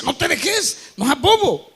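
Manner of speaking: rising, goading tempter voice; the flesh egging the driver on; tempting voice. Retold or imitated.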